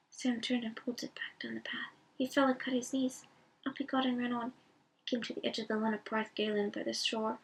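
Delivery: ordinary delivery, nothing marked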